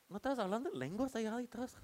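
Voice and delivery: voz aguda imitando a los hijos; hijos reclamando. Retold or imitated. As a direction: remeda a unos hijos que cuestionan a sus padres, agudo. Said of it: "voltean los hijos y te miran". (reproche infantil imitado)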